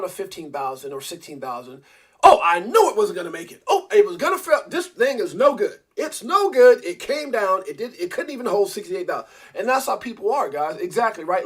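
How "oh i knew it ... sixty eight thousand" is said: much higher and louder, whiny told-you-so; mock panicky doubter. Host mocks friends who sneer as the market crashes, whiny and louder. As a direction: ham up a know-it-all who bailed when the price dropped.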